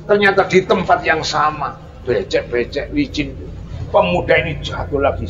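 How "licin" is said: ordinary talk, nothing marked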